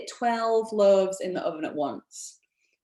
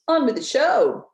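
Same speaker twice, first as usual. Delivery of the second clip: upbeat restart; 8 semitones higher, 7 dB louder than usual. Real, not acted. Host relaunches presentation.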